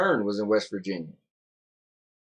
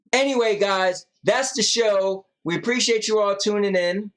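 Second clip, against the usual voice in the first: high whiny voice; 10 semitones higher, 4 dB louder than usual. Acted. Sick wife, ending show early.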